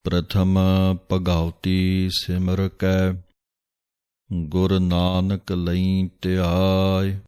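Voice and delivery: measured prayer recitation, each word drawn out; slow recitation. Shown as a demonstration. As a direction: recite the Ardas slowly and reverently. Slow devotional recitation of Ardas. (ਹੌਲੀ ਸ਼ਰਧਾ ਭਰਿਆ ਪਾਠ)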